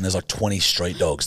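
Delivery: ordinary delivery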